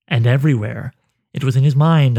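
ordinary delivery, nothing marked

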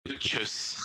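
soft, light echo of his line; imitating catchphrase. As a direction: quietly parrot the catchphrase. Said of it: "I love that one".

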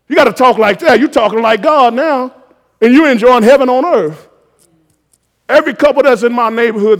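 loud, high preacher's shout; rising to a roar over the congregation; full-force preaching. Acted. Preacher to congregation, calling angels in a full-force shout.

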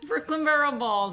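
put-on Brooklyn accent. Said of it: "that's so much fun to say".

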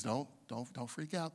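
calming inner voice. Retold or imitated. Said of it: "This is what he would tell me".

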